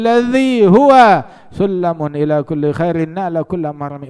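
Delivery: slow, drawn-out Arabic verse; declaimed religious poetry; poem recitation. Acted.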